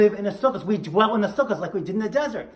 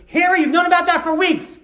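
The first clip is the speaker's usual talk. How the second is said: high, loud mock scolding; nagging wife; 11 semitones higher, 9 dB louder than usual. Wife contradicts her husband in front of the officer, loud nagging. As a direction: blurt it out as the exasperated wife, pitched way up.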